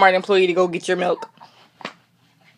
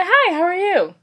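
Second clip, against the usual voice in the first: fake cheerful greeting; 9 semitones higher than usual. Cashier greeting the customer whose cat died, fake cheer. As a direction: pitch it up into a fake-friendly hello. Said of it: "And right after the next day".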